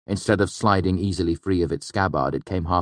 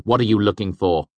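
impatient louder, higher question; testy; 4 semitones higher, 5 dB louder than usual. A narrator's reading. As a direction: demand an answer irritably. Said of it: "Kvothe demanded testily".

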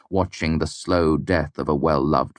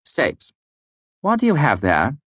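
stern higher mayor voice; 9 semitones higher than usual. A narrator's reading. Mayor confronts servant, stern higher demand. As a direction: demand it like a suspicious master. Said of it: "Alvaron's voice was stern".

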